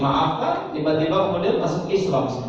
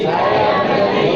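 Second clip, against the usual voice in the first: pledge echo; 6 semitones higher, 8 dB louder, slower than usual. Shown as a demonstration. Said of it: "siap praktek?".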